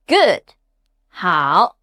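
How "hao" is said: "Hao." dipping low, then rising; low falling-rising "Hao."; third tone. Shown as a demonstration. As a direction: drop low in "Hao." and lift the end. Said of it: "common words with the third tone".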